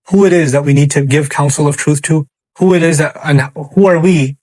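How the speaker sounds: normal talking voice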